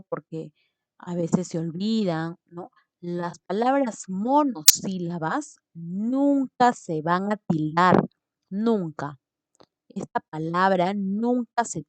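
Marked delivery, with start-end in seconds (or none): 3.0-8.1 loud, firm rule with nunca stressed; emphatic rule. Real, not acted. Teacher insisting to students, loud and firm. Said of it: "algo muy importante". 8.5-9.2 nunca said alone, loud, firm and falling; stressed nunca. Real, not acted. Teacher repeating nunca firmly to the class. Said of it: "algo muy importante".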